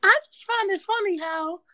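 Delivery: high, put-on commentator voice; mock think-piece writer. Co-host mocking non-voters' think pieces, high mocking voice. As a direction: pitch it way up, parodying a hot-take opener.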